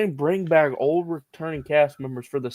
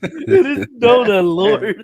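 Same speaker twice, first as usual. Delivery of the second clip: laughing; 10 semitones higher, 8 dB louder, slower than usual. Real, not acted. Joking, laughing, slow.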